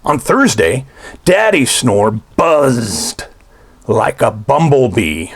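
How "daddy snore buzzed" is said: plain reading voice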